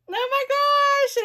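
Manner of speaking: very high, slow squeal of delight; thrilled squeal. Real, not acted. Gift receiver seeing the candle light up, thrilled squeal. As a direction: squeal with astonished delight, stretching it out.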